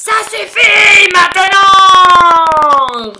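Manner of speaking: high, very loud, drawn-out roar. Acted. Lion has had enough of others' letters, furious roar. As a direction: roar it out at the end of your patience. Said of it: "rugit le lion".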